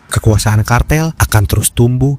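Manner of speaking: loud, steady documentary voiceover; documentary narration. Acted. Documentary narration over music.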